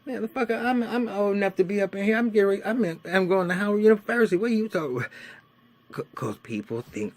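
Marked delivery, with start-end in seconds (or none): 0.1-5.1 much higher, louder, fast outraged protest; indignant rant. Retold or imitated. Seventeen-year-old protesting to the police, high and loud. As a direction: argue like an offended teenager.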